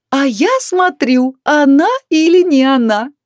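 high, drawn-out hollering; bawling loudly. A narrator's reading. Neighbour yells across whole canteen, bawling loudly. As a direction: bawl it for all to hear. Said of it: "продолжала орать оглобля".

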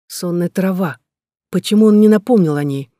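ordinary delivery, nothing marked

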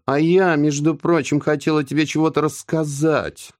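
teasing, dangling a secret; sly teasing. A narrator's reading. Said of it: "с хитрой усмешкой шепнула она".

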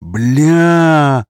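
howled curse. Acted. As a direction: wail the curse out. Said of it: "взвыл Раевский". (взвыл от отчаяния)

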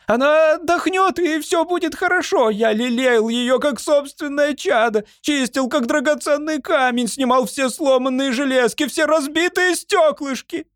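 very high-pitched, pleading servant voice; protesting servant. A narrator's reading. Servant defends his beloved weapon to the lord, protesting. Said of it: "запротестовал Ямбард".

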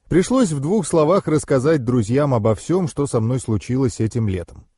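ordinary delivery

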